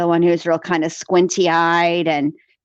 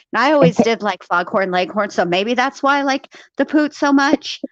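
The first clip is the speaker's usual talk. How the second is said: ordinary talk, nothing marked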